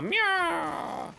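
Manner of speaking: high meow imitation; cat meow.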